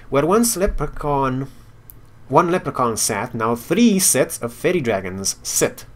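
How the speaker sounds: reading game text aloud like a storyteller; story narration. Acted. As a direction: narrate the quest scene with flair.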